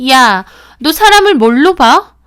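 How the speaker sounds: loud outraged demand; offended yell. Acted. Friend insulted by the narrator's offer, loud yell. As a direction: shout back, insulted.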